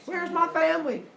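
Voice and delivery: high-pitched frantic demand; mock frantic yelling. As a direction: cry it out high between axe blows. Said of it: "starts yelling at the monsters".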